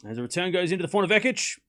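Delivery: tennis commentary building to an excited call; live play-by-play. Real, not acted. Calling Iala's break for stream viewers, building excitement.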